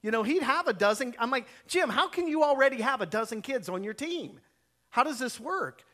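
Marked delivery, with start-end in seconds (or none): 1.7-5.8 higher-pitched, retold challenge; mock-indignant questioning; calling him out. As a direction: demand an explanation, in a storyteller's reenactment. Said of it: "I called him out on it".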